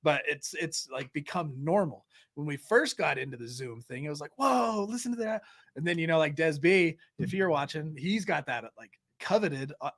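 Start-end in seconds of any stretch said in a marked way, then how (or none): 4.4-5.4 high-pitched mock wonder; imitated awestruck reaction; retold amazement. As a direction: act out being wowed. Retells first amazed reaction to accents.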